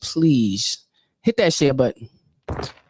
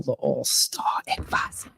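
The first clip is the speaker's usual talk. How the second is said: whispered aside; 2 semitones lower, 7 dB quieter than usual. Acted.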